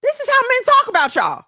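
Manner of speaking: high, loud wake-up call to the women. Real, not acted. Preaching at women who let men use them, loud and high.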